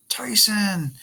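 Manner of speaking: high shouted name. Acted. Percy shouts to warn Tyson, high pitched. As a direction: call out to him in alarm. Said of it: "I shouted".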